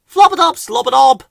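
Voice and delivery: high, loud, silly flowerpot-man voice; bright squeaky nonsense greeting; cheery call. A narrator's reading. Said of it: "called Bill and Ben cheerily".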